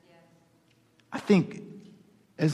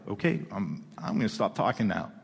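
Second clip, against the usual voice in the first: meek, much softer and lower; sheepish reply; 7 semitones lower, 8 dB quieter than usual. Retold or imitated. Startled Zechariah backs down before the angel, meek and soft. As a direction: shrink back and go quiet. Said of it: "it's like".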